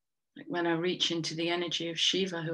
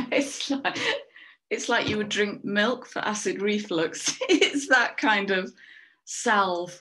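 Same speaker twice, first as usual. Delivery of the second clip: louder, breaking up with laughter; laughing while explaining; 3 semitones higher, 6 dB louder than usual. Real, not acted. Card reader amused by their milk-for-reflux comparison, laughing. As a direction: push the words out through real giggles.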